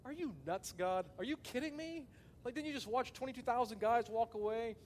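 high, disbelieving outcry at God; incredulous protest. Retold or imitated.

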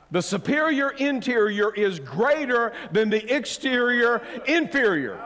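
forceful preaching. Real, not acted. Preacher repeating the tongue-twister to congregation, forceful preaching. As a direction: proclaim it high and loud to the crowd.

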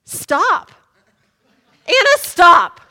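loud, high panicked order; emphatic command. Retold or imitated. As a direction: shout it as an urgent order to the driver. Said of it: "I repeat my emphatic".

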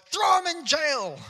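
high, loud shouted order; angry shout. Retold or imitated. Forgiven debtor shouting at the man who owes him, loud angry order. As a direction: bark the order, furious. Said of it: "the guy goes".

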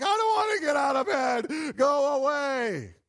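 high, loud, drawn-out mock yell of a sleepy wife; mock whiny shout. Wife shouting from bed at a late-night husband, mock whiny. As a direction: whine it loud and slow, pushing him away. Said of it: "she's like".

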